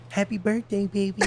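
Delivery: high, sweet-talk impression of partner; imitated partner. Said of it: "Charles like".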